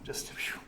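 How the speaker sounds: soft unvoiced breath out; calming exhale. Real, not acted. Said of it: "so back to being calm".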